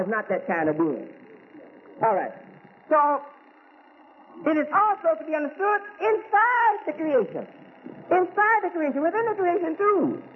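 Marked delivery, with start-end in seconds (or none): 2.9-10.2 higher, raised preaching voice. Acted. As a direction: lift it up and preach to the congregation. Preaching, repeating for emphasis.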